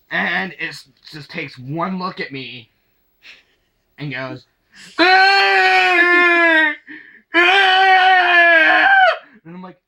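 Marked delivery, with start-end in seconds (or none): 5.0-8.9 put-on loud, high shrieking baby wail; imitated baby scream. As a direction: shriek twice like a toddler howling in fright.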